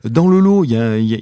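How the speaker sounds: usual speaking voice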